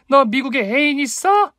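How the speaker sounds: very high yelled question, rising on 있어; full-force shout. A narrator's reading. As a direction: cry it out for everyone around to hear. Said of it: "젖먹던 힘을 짜내어 복식 호흡으로 외쳤습니다".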